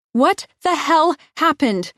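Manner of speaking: slow high furious yell. A narrator's reading. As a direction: yell each word in horror. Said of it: "I yell at him".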